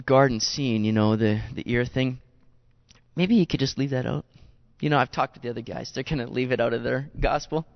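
a hint of an embarrassed Peter asking a favour; sheepish Peter. Peter quietly begging John to drop the ear story.

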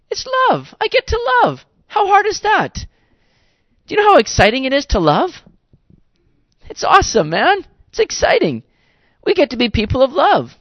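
high, bright-eyed burst of real excitement; joyful rising preacher excitement; excited joy. Real, not acted. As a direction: lift the whole line up with delight.

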